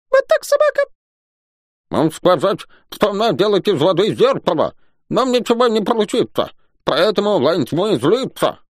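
high, squeaky little creature voice; self-important squeak. A narrator's reading. Little Юми reporting what he has seen, important squeaking. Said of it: "важно пискнул".

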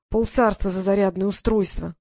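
usual speaking voice

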